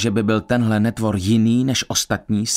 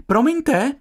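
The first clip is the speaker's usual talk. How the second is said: high, ringing announcement; hailing the group; 13 semitones higher than usual. A narrator's reading. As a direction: sing out to everyone, pitched way up. (zahlaholení)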